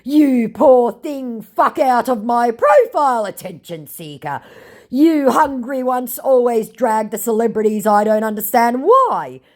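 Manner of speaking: hammed-up furious scammer; mock angry scammer. Scammer lashes out at her, a mocked furious rant. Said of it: "To which he says".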